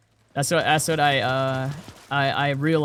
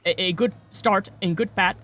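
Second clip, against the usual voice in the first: hammed-up scam recruiter reading lines; scam caller; 4 semitones higher than usual. Mocking a scam recruiter calling him, halting and unsure. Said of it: "they sound off".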